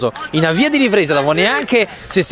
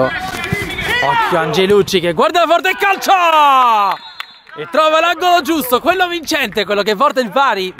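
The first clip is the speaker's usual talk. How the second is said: high, slow, stretched goal shout; breathless italian goal commentary; 8 semitones higher, slower than usual. Real, not acted. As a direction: call the goal at full cry.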